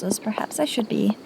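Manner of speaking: hushed, breathy whisper. Acted. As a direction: lean in and whisper it. Lowers voice, keeping talk private.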